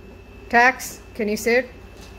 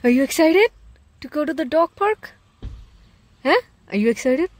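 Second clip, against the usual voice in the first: excited pet talk; 6 semitones higher, 3 dB louder than usual. Real, not acted.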